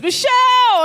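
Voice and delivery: high, loud drawn-out hail; delighted greeting. Retold or imitated. Deli man hailing a regular customer, delighted greeting. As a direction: call out the name like greeting a regular.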